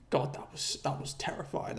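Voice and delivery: soft whisper, still shaken; awed whisper. Real, not acted. Vlogger recalling the night dog, shaken whisper. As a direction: breathe it out like the fear is back.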